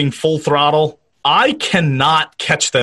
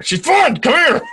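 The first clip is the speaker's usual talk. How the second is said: high urgent coaxing; 12 semitones higher than usual. Pretend. Voicing the doctor, urging her.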